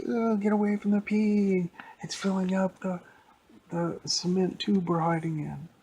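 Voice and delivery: high loud grossed-out yelling; disgusted shriek. Acted. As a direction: scramble away squealing. Kids disgusted by pee.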